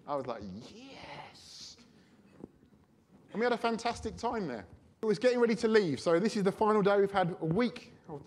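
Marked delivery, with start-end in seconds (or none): 0.7-1.8 breathless whispered yes of delight; a hushed inner yes. Retold or imitated. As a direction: hold the joy in, barely voice it. Inner delight at hotel upgrade.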